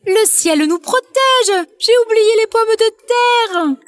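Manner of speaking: cri perché, mots étirés, sans forcer le volume; exclame-toi très aigu, en traînant; exclamation de poule. A narrator's reading. La poule s'exclame, faussement catastrophée. (mère poule qui s'exclame, voix suraiguë)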